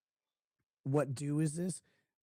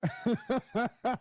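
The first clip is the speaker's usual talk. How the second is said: short laugh; 8 semitones higher, 3 dB louder than usual. Real, not acted. Laughing at clip.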